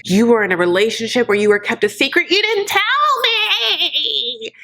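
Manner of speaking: mock telenovela wail climbing high at the end; overdramatic outrage. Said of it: "it is so overdramatic".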